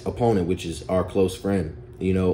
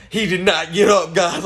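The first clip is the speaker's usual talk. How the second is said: higher, louder, laughing retelling; laughing repeat; 7 semitones higher, 4 dB louder than usual. Real, not acted. Teller repeating to viewers, laughing emphasis. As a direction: say it again cracking up.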